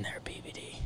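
whispered aside to the co-host; secretive whisper to BBD. Real, not acted. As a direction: lean in and whisper it. Aside to co-host about chat.

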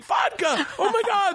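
thrilled to grab the vodka; thrilled echo. Retold or imitated. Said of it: "I was like".

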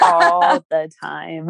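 drawn out, leaning on all; slow emphatic echo. Real, not acted. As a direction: stretch every word, knowingly. Repeats the phrase slowly.

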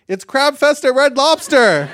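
very high, loud ad shout; blaring commercial announcer. Acted. Video ad interrupting a guided meditation, blaring announcer shout. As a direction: burst in like an obnoxious commercial.

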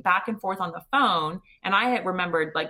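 usual speaking voice

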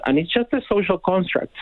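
ordinary talk

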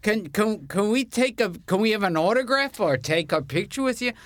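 high, eager fan voice; excited fans. Retold or imitated. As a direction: gush like a starstruck garbage man. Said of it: "they go".

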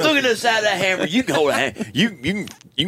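high, excited, rattled-off chatter; Bobby. Retold or imitated. Friend Bobby commenting mid-movie, high excited voice. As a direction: keep exclaiming at the screen. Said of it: "my friend Bobby".

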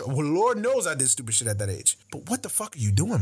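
ordinary talk, nothing marked

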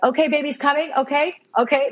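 mock urgent excitement; excited staff imitation. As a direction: imitate the team announcing an imminent birth. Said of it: "start getting a little loud".

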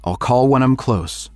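a father pleading over the phone; worried father. Acted. Father voiced in story.

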